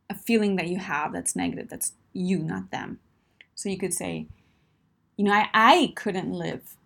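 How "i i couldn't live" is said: second "I" drawn out and emphasized; stress on I. Shown as a demonstration. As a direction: lean hard on "I", slowly. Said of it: "You're always gonna use "I".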